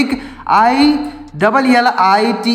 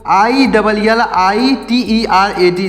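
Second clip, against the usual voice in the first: normal talking voice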